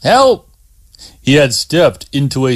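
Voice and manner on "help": high loud call for help; cry for help. Acted. Scott hanging from the snare calls out, loud and high. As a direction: yell out, suddenly trapped.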